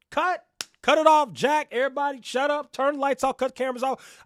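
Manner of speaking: high, loud shouted orders; calling a halt. Acted. Host playing interviewer shutting down the shoot, shouting. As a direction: shout down the whole set, urgently.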